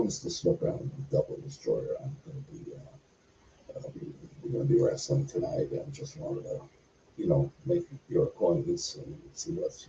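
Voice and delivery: whispered, tiptoeing into a dark locker room; hushed whisper. Retold or imitated. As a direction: breathe it, afraid to disturb him. Nervously introducing himself to Snuka.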